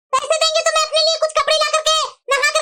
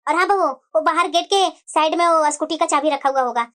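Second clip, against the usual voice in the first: put-on elderly mother-in-law; 8 semitones lower than usual. Mother-in-law sending daughter-in-law out. (बूढ़ी सास की धीमी, गहरी आवाज़)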